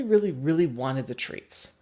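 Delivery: ordinary delivery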